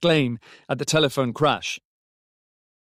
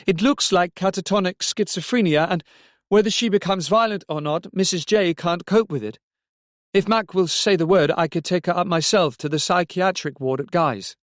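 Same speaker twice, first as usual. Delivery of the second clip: higher-pitched, insistent character voice; raised, pressing voice down the telephone; urgent Robbie on the phone; 7 semitones higher, 3 dB louder than usual. A narrator's reading.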